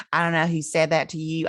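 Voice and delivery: high-pitched Southern twang; Southern accent. Retold or imitated. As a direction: put on a Southern foster mom. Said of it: "she has a Southern accent".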